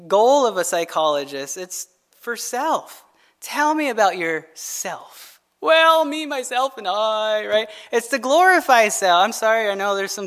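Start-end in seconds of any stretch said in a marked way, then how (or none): 3.4-5.4 slow, drawn-out therapist prompt; a hint of a therapist, stretching out "yourself"; lightly voiced psychologist. Psychologist drawing a patient out about himself, lightly mocking. 5.6-7.5 self-absorbed patient. Acted. Patient answering the psychologist about himself, mock self-important.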